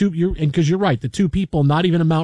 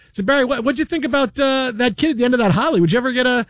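higher voice, mock broadcaster asking; lightly voiced anchor tossing to Barry, pitched up; imitating Bill Pito; 7 semitones higher than usual. Host asks co-anchor about the kid's haircut, higher mock voice. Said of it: "Bill says".

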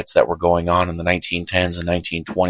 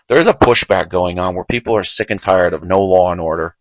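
usual speaking voice

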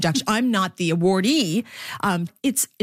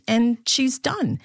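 ordinary delivery

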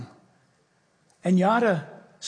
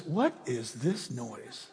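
soft, slow, low wondering question; puzzled kid; 10 semitones lower, 11 dB quieter, much slower than usual. Retold or imitated. Preacher voicing young David arriving at camp, soft slow puzzled question. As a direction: ask it quietly, drawn out, like a curious boy. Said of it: "One kid shows up and says".

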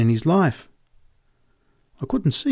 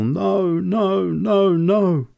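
terrible echoing no; 7 semitones higher, much slower than usual. A narrator's reading. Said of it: "his terrible 'No".

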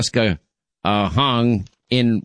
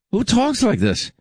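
much higher, pitched up in disbelief; incredulous outburst; 11 semitones higher than usual. Real, not acted. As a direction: throw it out in appalled disbelief. Disgusted host repeats question.

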